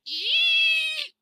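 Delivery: high piggy squeal imitated; a sudden high little-pig "Ee!". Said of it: "It sounded like a little pig".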